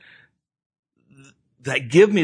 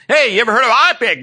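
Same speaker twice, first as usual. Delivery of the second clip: higher, louder, fast blurt; 10 semitones higher, 7 dB louder, faster than usual. Retold or imitated. Wife blurting to Papa Jack at lunch, fast loud blurt. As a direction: spill it out quickly, much higher and louder. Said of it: "Susie blurts out".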